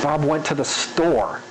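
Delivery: slow, with a long drawn-out "store"; lingering. Shown as a demonstration. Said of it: "where you linger".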